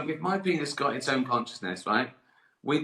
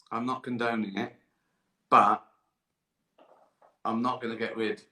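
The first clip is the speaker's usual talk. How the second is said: ordinary delivery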